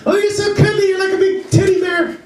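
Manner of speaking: very high, cutesy squeal; sugary high-pitched female voice; gushing women. Retold or imitated. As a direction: coo it like a mock compliment. Mimicking gushing women's compliment.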